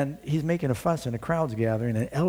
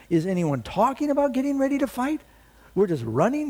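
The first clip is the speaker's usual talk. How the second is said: urgent challenge; 8 semitones higher than usual. Acted. David rallies the fleeing soldiers.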